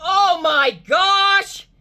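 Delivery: drawn-out, high shout of amazement; excited shout. Real, not acted. Tester seeing a record number, shouting slowly and high. Huge reading at thirty hertz.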